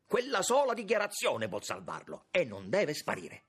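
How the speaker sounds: high, loud, urgent insistence; pressing insistence. A narrator's reading. Young man presses to save his brother, loudly insistent. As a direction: press the case forcefully. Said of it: "incalzò Lauriti".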